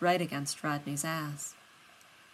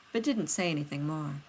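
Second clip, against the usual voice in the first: ordinary delivery, nothing marked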